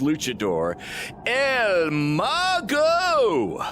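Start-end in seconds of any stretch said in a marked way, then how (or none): 1.3-3.6 drawn-out high announcer cry; ring announcer call. Acted. Promoter hypes the luchador name, drawn-out and loud.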